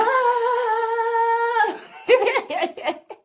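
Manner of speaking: high loud wailing laugh-cry; trance wail. Retold or imitated. Reliving her trance at the sangoma's, a high wailing cry. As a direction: let out a loud, high, half-sung wail. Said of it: "I'm chanting and I'm just shouting".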